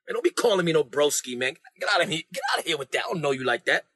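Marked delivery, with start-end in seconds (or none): none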